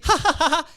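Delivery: high, loud, hammed-up belly laugh; booming pretend cackle; loud mock laugh. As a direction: laugh out loud as Sara would not dare. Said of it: "Si se ríe".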